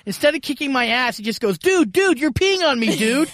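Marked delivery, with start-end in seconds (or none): 1.6-3.3 high, loud startled protest; startled dude. Retold or imitated. Stranger waking up to being peed on, high and loud. Peed-on guy reacting, retold.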